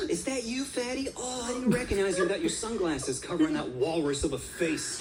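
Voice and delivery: high-pitched, fake-delighted taunt; mock surprise. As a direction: feign happy recognition to needle someone. Storyteller acts taunting the Karen.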